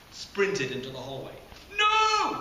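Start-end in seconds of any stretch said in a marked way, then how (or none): 1.8-2.4 a sharp, high, loud cry of no; shout. Acted. Jared warning Dad off the skateboard, loud shout. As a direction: yell the warning. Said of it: "Jared shouted".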